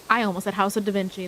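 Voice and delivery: ordinary delivery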